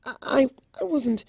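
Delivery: high, timid stammering denial; flustered stammer. Acted. Fluttershy caught out by Discord, stammering high. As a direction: trip over the words in shame.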